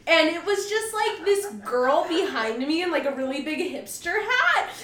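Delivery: ordinary talk, nothing marked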